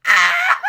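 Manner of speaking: high squeal melting into a laugh; excited shriek. Real, not acted. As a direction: shriek with glee, then laugh. Squealing at a romantic moment.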